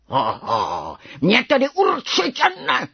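high-pitched, forceful defiance; little tiger roar. A narrator's reading. Little tiger defies the worst mouse, roaring. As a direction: growl the opener, then roar it high. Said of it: "zařval malý tygr".

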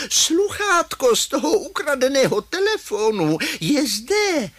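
high-pitched grandma calling from afar. Acted. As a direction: call it out high across the square. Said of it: "volá". (vysoké volání babičky)

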